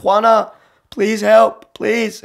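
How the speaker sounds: high-pitched cry for help; desperate plea. Retold or imitated. Calling the translator for help, high desperate plea. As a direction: call out urgently, pleading.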